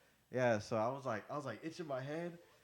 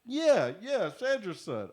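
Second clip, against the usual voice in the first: eager bluff; 7 semitones higher, 9 dB louder, slower than usual. Retold or imitated.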